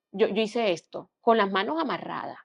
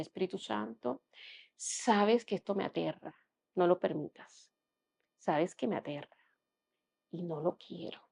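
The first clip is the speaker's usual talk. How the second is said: hushed pleading prayer; 8 dB quieter than usual. Retold or imitated. Pleading prayer while intubated.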